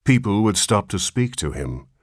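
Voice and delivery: ordinary delivery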